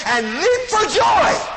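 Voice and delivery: high, loud, drawn-out cry of joy; joyful shout. Acted. Preacher acts out leaping for joy.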